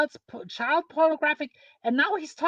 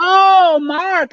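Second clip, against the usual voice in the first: calling out to Mark, loud and higher; 4 semitones higher, 12 dB louder than usual. Real, not acted. Streamer calling out to Mark across the stream, loud. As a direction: shout his name to grab his attention.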